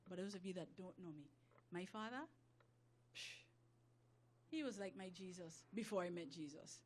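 low, soft, personal testimony; subdued confiding. Real, not acted. Confiding hurt about her father.

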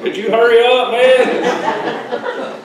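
loud, high, mocking the impatient line; impatient crowd. People waiting behind him, a loud impatient mock complaint. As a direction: imitate the annoyed people waiting. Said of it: "everybody's like".

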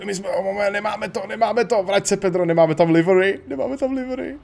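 high-pitched flustered alarm; rising, frantic squeal of dismay; mock panic. Real, not acted. Gamer realising the livery is missing, panicked.

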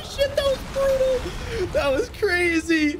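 very high, stretched-out exclaiming; high whooping astonishment; thrilled amazement. Real, not acted. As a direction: marvel at it in a high pitch. Player amazed by a brutal finisher.

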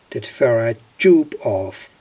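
normal talking voice

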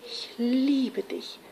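hushed romantic longing; tender love declaration. Acted. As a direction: linger softly over the words. Shepherd declares love, trembling with longing.

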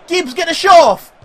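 excited shout. Real, not acted. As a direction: yell high and loud at the player. Urging player in live match.

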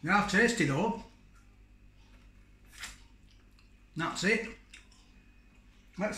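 nibbling between words; talking while chewing. Real, not acted. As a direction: mouth partly full of meat. Seen 0: Bites rib before, chews and nibbles it while talking throughout.

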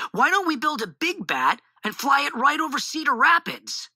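high, eager storybook shout; excited shout. A narrator's reading. Boy proposes a big bat to the family, shouting eagerly. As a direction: call out a big idea, pitched well up. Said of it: "I shouted".

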